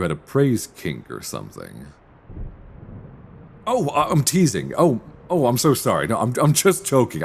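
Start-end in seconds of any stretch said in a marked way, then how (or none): 3.7-7.2 higher, louder, hurried embarrassed backpedaling; flustered apology. Acted. Professor to student after a joke, flustered higher apology. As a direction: scramble to take the joke back.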